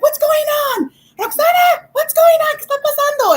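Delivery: very high mock-mom holler; mother calling out. Mom demanding what is going on, imitated holler. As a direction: shout it as if through a door, shrieky and bilingual. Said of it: "my mom's like".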